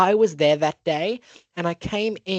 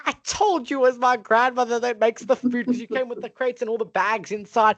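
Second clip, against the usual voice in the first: high-pitched retold I-told-you-so; triumphant insistence; 7 semitones higher than usual. Child proved right about who cooks, triumphant insistence. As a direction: press the point home, high and emphatic.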